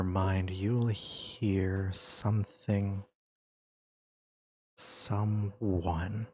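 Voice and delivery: slow, hushed scene narration; game master's scene setting. Acted. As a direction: draw them into a dreamlike scene. GM sets the dream scene.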